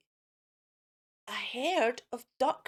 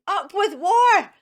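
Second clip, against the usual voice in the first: high, loud, slow battle cry; roaring war cry; fierce shout; 7 semitones higher, 11 dB louder than usual. Acted. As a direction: bellow it like a war chant. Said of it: "And they shouted".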